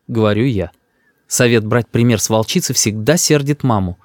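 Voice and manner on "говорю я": usual speaking voice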